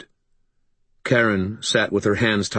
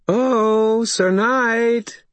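high, drawn-out taunting call; 4 dB louder, much slower than usual. A narrator's reading. Maeve to Harry from outside the cottage, taunting call. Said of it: "Maeve called from outside".

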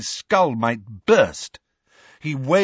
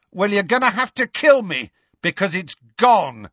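raised, defiant shout; roaring; 9 semitones higher, 3 dB louder than usual. A narrator's reading. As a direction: roar back at the enemy. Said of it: "Harry roared".

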